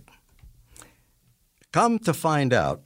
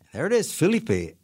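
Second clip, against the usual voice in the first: ordinary talk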